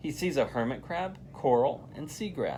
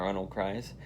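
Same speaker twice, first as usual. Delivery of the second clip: plain reading voice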